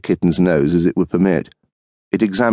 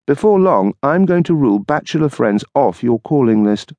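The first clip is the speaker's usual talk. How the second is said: higher, lightly voiced wife, tart; dry retort as the wife; wife's voice; 7 semitones higher than usual. Wife waiting up for her husband, tart retort.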